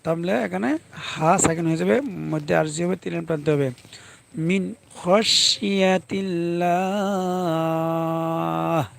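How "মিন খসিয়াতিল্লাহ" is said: slow, drawn-out chanted Quran recitation. Shown as a demonstration. Teacher chanting the verse for students, slow recitation. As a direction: chant each syllable slowly like a recitation.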